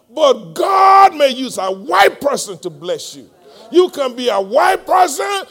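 loud, high, shouted preaching; full-force preaching. Real, not acted. Preacher pouring heart out to the church about biases, full-force preaching. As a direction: cry it out to the congregation, leaning hard on key words.

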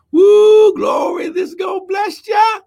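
very high, loud whooping praise; shrieked church exultation; ecstatic shout. Real, not acted. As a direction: whoop it out in sudden joy. Ecstatic outburst while preaching.

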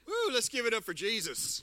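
a high, loud Woo that rallies the room; excited cheer. Real, not acted. Worship leader rallying the congregation, a high excited cheer. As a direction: whoop the crowd up for Jesus.

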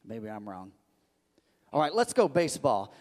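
ordinary talk, nothing marked

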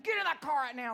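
furious mom ordering her son into the car; angry order. Retold or imitated. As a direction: snap at the kid to get in the car.

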